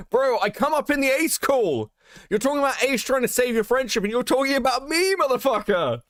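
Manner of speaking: high-pitched, incredulous rant; indignant outburst. Real, not acted. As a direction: fire back in exasperated disbelief. Indignant rant after mocking the excuses.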